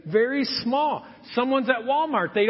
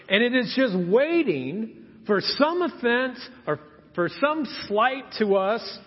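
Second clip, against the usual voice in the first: ordinary talk, nothing marked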